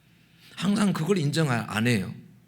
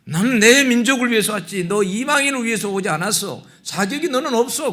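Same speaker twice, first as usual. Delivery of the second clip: loud, higher, harsh dismissal; stern rebuke; 10 semitones higher, 10 dB louder than usual. Retold or imitated. Preacher voicing the Lord telling the woman off loudly. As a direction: shout him down as unworthy. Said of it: "주님이 그러잖아요".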